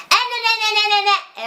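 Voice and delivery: put-on high, nagging child whine; annoying whiny kid. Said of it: "criança chata".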